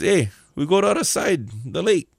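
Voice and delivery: local Hawaiian style. Shown as a demonstration. Jesus suggesting a trip to his guys, Hawaiian pidgin. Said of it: "kinda like local style".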